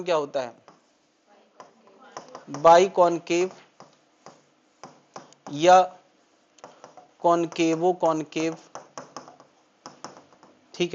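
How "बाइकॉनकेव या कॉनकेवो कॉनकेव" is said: slow term naming. Shown as a demonstration. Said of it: "teacher names lens types".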